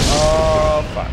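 sudden loud dismayed cry; loud outburst. Real, not acted. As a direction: blurt it out in alarm. Ship takes heavy damage.

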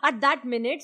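ordinary delivery

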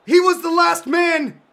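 high, loud outraged yelling; furious shout at ref. Real, not acted. Gamer screaming at the ref for a red card. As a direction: bellow at the referee for a red. Yelling at referee over foul.

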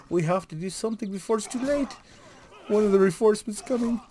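urgent little leprechaun sounding the alarm. Acted. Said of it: "I'm doing Leprechaun now".

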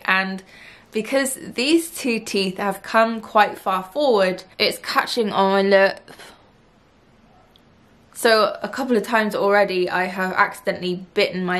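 talking with finger on lip; finger pulling lip down. Real, not acted. Seen 1: Finger pulls lower lip down exposing braces through most of the labelled words.

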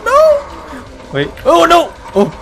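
urgent questions climbing into shrieks; rising panic. Real, not acted. Player finding it's behind them, panicked. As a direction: start alarmed, build to a high scream.

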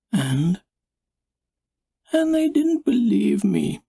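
high, whispered whine; pouty wounded whine; whiny pup. Acted. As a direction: whimper it like a sulky pup. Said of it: "Stella whined".

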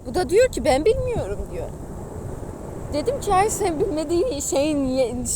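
ordinary talk

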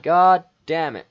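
loud, slow, high curse; exasperated cursing. Real, not acted. Player learns how the character died, exasperated shout. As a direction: drag out the curse, loudly. Player reacts to game death.

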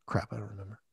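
low, soft muttered aside to himself. Real, not acted. Losing his train of thought in an interview, muttering quietly. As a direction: grumble it under your breath, losing the thread. Forgets his point mid-answer.

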